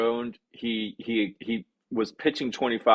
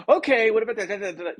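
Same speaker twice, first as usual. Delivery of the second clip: eager acceptance; 8 semitones higher, faster than usual. Shown as a demonstration. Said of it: "You don't say".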